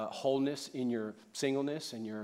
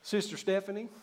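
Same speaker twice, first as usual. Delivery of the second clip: country church greeting; 8 semitones higher than usual. Retold or imitated. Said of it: "they all sound so country".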